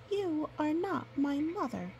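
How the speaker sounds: a lightly voiced little wolf pup.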